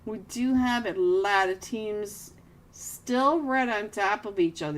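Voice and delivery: ordinary delivery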